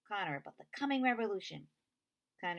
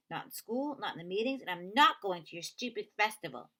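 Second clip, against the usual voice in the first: angry; 5 semitones higher, 6 dB louder than usual. Acted. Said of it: "Connor angry outburst".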